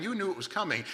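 usual speaking voice

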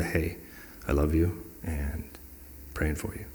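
low, soft, caring reassurance; tender. Acted. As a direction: comfort a hurting friend quietly. What the hurting person needed.

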